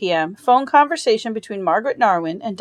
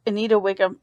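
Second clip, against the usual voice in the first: usual speaking voice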